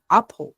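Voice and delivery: "apple" starting on an open vowel; vowel onset. Shown as a demonstration. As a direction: show the vowel that begins "apple". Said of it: "tiene un sonido como una vocal". ("apple" con sonido inicial de vocal)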